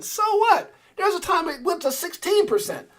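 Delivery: loud and high quoting of a buddy; friend Garrett. Retold or imitated. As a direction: shrug it off, fired up. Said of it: "He's like".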